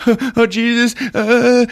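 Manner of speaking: high, loud imitated distress; mock panic. Trapped woman giving up at door, mock panic. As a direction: whimper up in panic. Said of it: "She just".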